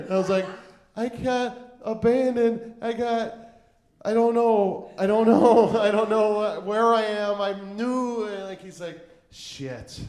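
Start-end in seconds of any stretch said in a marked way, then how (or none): 1.0-8.2 high, loud, lost whine; hammed-up pitiful confusion; helpless pleading. Drunk, lost, pleading to acquaintance. 9.3-10.0 low, soft exasperated curse; acted reluctant sigh of a word; grudging resignation. Grudging reaction of reluctant rescuer.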